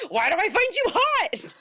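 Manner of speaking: high, loud mock anguish; the smitten coworker, groaning in high comic agony; lovestruck whine. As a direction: squeal it in comic torment. Coworker voiced, laughing.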